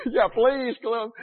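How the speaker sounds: loud, laughing, grudging invitation; mock reluctant welcome. Youth minister grudgingly inviting the annoying kid, laughing. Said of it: "he said".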